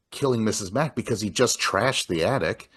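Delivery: ordinary delivery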